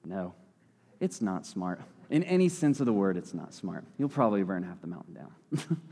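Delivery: low and soft, sweetly patient; quiet, kindly parental refusal; sweet calm parent. Pretend. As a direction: smile through it and gently say no. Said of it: "just sweetly smile and say".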